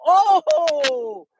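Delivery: a high, loud "Oh!" of sudden recognition; the name rings a bell and he yelps; surprised recognition. Retold or imitated. Keyshawn hearing Ojo's name, surprised high yelp. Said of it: "Keyshawn go".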